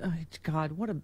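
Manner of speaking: ordinary talk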